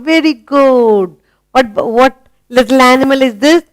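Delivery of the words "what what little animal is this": ordinary delivery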